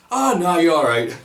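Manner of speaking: high, loud mock-polite decline; retold embarrassed brush-off; awkward refusal. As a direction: turn it down awkwardly in front of a crowd. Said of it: "really awkward second pause and went".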